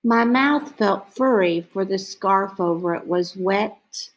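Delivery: ordinary delivery, nothing marked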